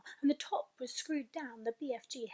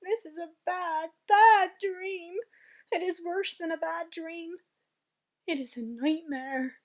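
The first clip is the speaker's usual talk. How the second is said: horrified; 5 semitones higher, 8 dB louder than usual. Acted. BFG horrified at nightmare.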